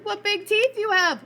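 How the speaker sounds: high, loud terrified cry. Acted. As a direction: cry out in fear. Girl terrified.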